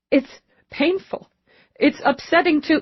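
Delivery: high, halting, pained voice; tearful. Acted. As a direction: struggle to speak through tears. Weeping mother admits her pain.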